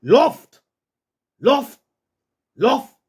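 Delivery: high, firm go order; authoritative command. Shown as a demonstration. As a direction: send the dog off. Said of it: "you got to say it with authority".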